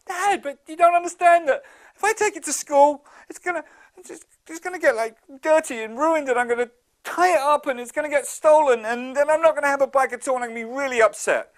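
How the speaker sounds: put-on high, loud whining little boy; whiny kid. Gloomy boy complains to his dad about the bike, whining high and loud.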